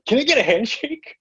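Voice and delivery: high-pitched eager fan request; starstruck eagerness. Retold or imitated. Fan begging a famous actor for contact, starstruck and eager. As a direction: re-enact an overexcited fan, voice leaping up.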